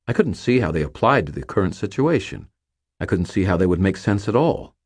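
plain reading voice

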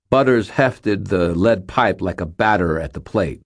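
usual speaking voice

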